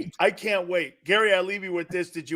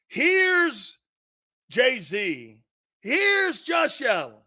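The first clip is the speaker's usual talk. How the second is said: higher, slow hyped-up introduction; announcer intro; 6 semitones higher, much slower than usual. Shown as a demonstration. Host mock-introducing star quarterbacks, hyped announcer call. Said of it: "watch this".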